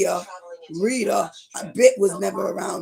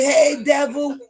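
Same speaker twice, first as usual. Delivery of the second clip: calling out to him; 4 semitones higher, 6 dB louder, slower than usual. Retold or imitated.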